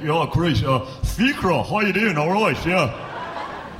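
put-on affected Trinity student accent, drawn-out ending. Comic imitates Trinity students greeting each other, mock Trinity accent. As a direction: mimic a Trinity student, stretching the last words. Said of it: "you go into Trinity now, they're all like".

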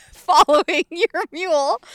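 high, loud, laughing through the words; real laughing. Real, not acted. Teller describes the walk of shame, laughing loudly. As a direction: break up laughing as you finish.

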